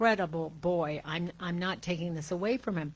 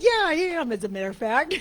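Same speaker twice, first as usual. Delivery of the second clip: much higher and louder through laughter; laughing retort; 9 semitones higher, 7 dB louder, faster than usual. Real, not acted. Grandmother laughing back at daughter in the hospital room, laughing. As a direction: answer while still laughing.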